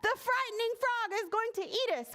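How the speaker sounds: high, fast storybook panic; high-pitched, hurried alarm; panicked cry. A narrator's reading. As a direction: cry out as terrified little bugs. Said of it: "cried her friends".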